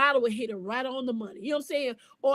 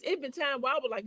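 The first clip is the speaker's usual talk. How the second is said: normal talking voice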